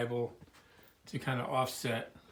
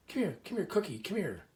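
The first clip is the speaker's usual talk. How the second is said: high coaxing call of come here to a dog; coaxing a dog out of the kitchen, high and sing-song; 7 semitones higher than usual. Retold or imitated. Manager calling the dog over.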